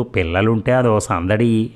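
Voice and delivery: ordinary delivery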